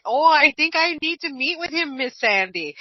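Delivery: imitating the boss in a light Irish brogue; lilting Irish brogue, lightly put on; mock Irish brogue. Host mimics her Irish-accented boss, a hint of Irish brogue. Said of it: "and he's got an Irish brogue".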